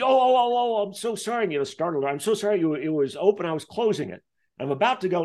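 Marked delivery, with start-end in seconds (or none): 0.0-1.4 much higher, flustered, startled oh oh oh; a caught thief blurting sorry; hammed-up startled cleaner, much higher; startled apology. 2.1-5.2 higher, hurried cleaner making excuses; lightly voiced nervous cleaner, higher; flustered excuse. Cleaner keeps excusing herself.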